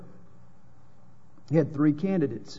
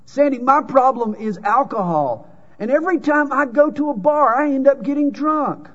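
higher, louder confessing man; a man owning up to his drinking, pitched up and loud; voiced confessor; 8 semitones higher, 5 dB louder than usual. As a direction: play up the sheepish drinker's complaint. Second congregant confesses.

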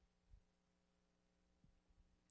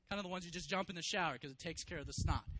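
normal talking voice

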